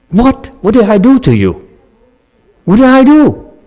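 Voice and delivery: high-pitched, protesting questions; indignant reaction. Acted. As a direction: act out a startled protest at being yelled at. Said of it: "your thought is that".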